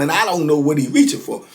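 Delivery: usual speaking voice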